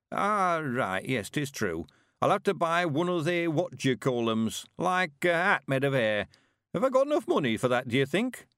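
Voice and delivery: put-on an eager old countryman, chuffed; old Mister Weevil. Old man voice held.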